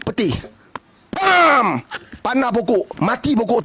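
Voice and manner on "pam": louder mock bang of a lightning strike; sudden imitated strike; lightning crack imitation. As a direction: slap the sound out like thunder.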